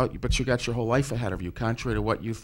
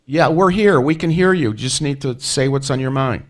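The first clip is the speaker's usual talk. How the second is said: loud and higher, over a bad line; to someone hard of hearing; 5 semitones higher, 10 dB louder than usual. Real, not acted. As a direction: project clearly, as to a faint caller. Host straining to reach faint caller.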